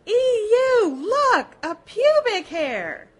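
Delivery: high, loud, shrill disgusted squeal; loud high squealing "Eww, look"; squealing girls. A narrator's reading. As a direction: squeal it out like a grossed-out girl. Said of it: "blonde squeals reel and peel".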